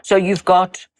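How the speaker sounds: ordinary talk, nothing marked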